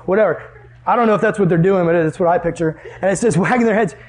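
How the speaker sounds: ordinary talk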